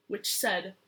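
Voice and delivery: ordinary delivery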